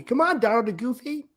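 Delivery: put-on high, cheerful boyish hero voice; upbeat cartoon hero call; Sora voice. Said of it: "too deep for Sora".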